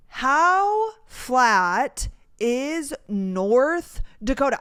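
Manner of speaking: very slow, stretched question; slowly spelled-out rhetorical question; slow, drawn out. Real, not acted. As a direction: draw it out for suspense. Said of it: "building suspense".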